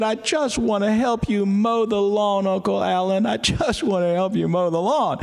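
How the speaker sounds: put-on high pleading little-boy voice, mildly tearful; high, insistent mock-upset child; crying toddler. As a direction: imitate an upset toddler begging to help. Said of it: "screaming ... just crying his eyes out".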